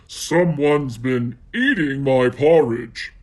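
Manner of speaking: Daddy Bear finds his porridge eaten, growling; growled. Acted. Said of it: "he growled".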